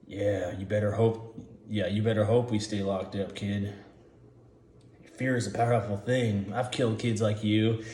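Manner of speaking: soft, low convict threat; menacing prisoners. Acted. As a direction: quietly threaten the kid. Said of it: "all the prisoners are like".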